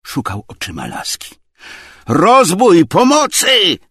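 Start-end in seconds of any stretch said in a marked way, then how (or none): 2.1-3.8 very high, loud yell; screaming for help. A narrator's reading. Tarantiew cries robbery as Obłomow threatens, screaming. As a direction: scream in alarm. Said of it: "wrzeszczał Tarantiew".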